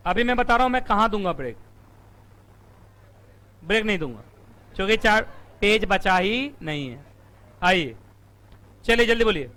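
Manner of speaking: normal talking voice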